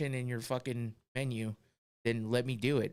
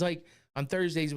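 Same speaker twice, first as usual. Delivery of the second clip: ordinary talk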